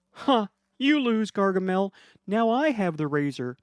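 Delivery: high, smug gloat. Acted. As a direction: gloat at the villain. Billy gloats.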